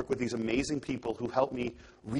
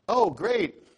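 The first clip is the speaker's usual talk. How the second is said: high, bright-pitched "Oh, great" faking recognition; fake delight; 10 semitones higher, 5 dB louder than usual. To a student he can't place, feigned delight. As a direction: cover not knowing her with forced cheer.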